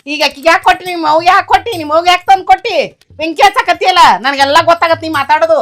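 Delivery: loud, high, furious questioning; angry shouting. Acted. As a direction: shout the accusations at her. Mother confronts daughter-in-law.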